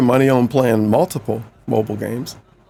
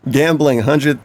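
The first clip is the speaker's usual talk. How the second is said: ordinary talk, nothing marked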